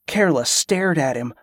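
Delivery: usual speaking voice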